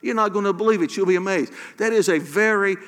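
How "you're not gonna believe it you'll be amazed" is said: like a friend who can't wait to tell; playful hyped-up voice of God; excited buddy God. Retold or imitated. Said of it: "It's almost like".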